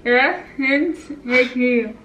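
ordinary talk, nothing marked

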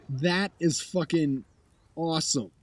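ordinary talk, nothing marked

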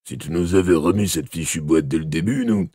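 put-on policeman voice, reproachful; accusing Boyle. A narrator's reading. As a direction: grumble the blame in a character voice. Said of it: "intervint Boyle".

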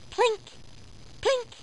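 tiny high-pitched plinks; short, high drip sounds, spaced apart; imitated dripping plink. A narrator's reading. Storyteller sounding out a noise in the night, high plinks.

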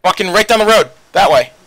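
angry urgent yell; shouted direction. Real, not acted. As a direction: bark the direction loud. Directing fire in combat.

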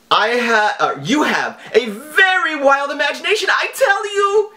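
high, theatrical villain read of the email; mock scammer voice. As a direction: read the scam letter like a hammy hacker. Reading scam email in mock voice.

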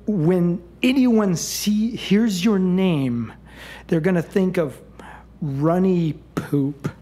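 slow, drawn-out incantation; witch casting spell. Acted. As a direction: pronounce a curse, stretching every word. Witch pronounces her curse on the girl.

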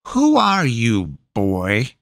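lightly voiced suspicious crone; a hint of the witch's curiosity; probing witch. A narrator's reading.

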